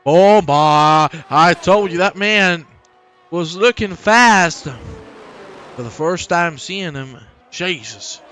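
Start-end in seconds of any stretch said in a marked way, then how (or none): 0.0-4.6 high, loud, exultant commentator shouting; excited race call. Real, not acted. Commentator hails a driver taking the lead, excited race call. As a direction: stutter into a roar, then stretch out the big news.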